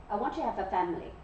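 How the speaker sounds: ordinary talk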